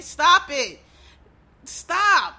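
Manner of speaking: sharp, loud, high stop; exasperated shout. Real, not acted. Fed up with gender reveal parties, a loud exasperated stop. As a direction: cut it off with a loud, fed-up bark.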